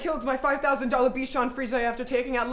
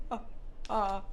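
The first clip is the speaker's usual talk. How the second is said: usual speaking voice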